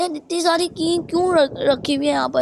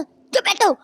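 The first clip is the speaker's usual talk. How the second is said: higher, strained snap; voice cracking from shouting; 6 semitones higher, slower than usual. Real, not acted. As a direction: voice cracks as he tells him to hush. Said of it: "चिल्लाता हूँ तो मेरी आवाज़ ऐसी हो जाती है".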